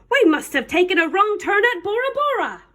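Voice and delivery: high-pitched pirate exclamation. A narrator's reading. Pirate tells the boy they took a wrong turn, exclaiming. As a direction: play a storybook pirate working it out. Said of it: "the pirate said".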